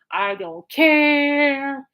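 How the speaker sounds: very slow, loud stretched-out last word; milk the final vowel sarcastically; imitating exaggerated indifference. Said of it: "hearing [name]'s radio talk show".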